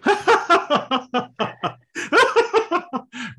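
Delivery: loud, high burst of laughter; demonstrated laughing. Shown as a demonstration. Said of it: "even with something like laughing".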